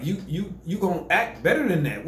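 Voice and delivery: ordinary delivery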